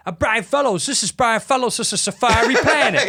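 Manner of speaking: high-pitched, loud, slurred sketch-character voice; Tracy Morgan. Retold or imitated. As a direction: stumble loudly through the S's in a high squeal. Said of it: "we knew Tracy would go".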